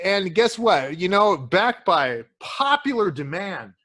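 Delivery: ordinary talk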